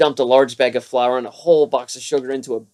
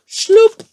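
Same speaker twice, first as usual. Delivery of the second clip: sound effect; 8 dB louder than usual. Acted. Baking powder dumped in.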